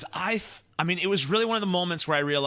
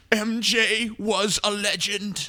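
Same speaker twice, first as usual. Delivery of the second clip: mocking eulogy; 7 semitones higher, slower than usual.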